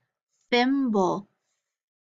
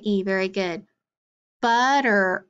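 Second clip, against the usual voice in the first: ordinary talk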